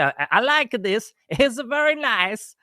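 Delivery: put-on Borat accent. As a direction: ham up the Borat accent for laughs. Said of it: "my Borat accent".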